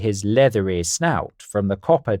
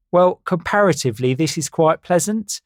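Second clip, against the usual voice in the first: high bird voice; 8 semitones higher, 3 dB louder than usual. Acted. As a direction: play a silly know-it-all bird. Carl voiced in story.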